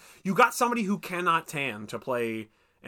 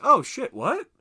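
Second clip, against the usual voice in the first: mock surprise; 10 semitones higher, 7 dB louder, slower than usual. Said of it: "And you're like".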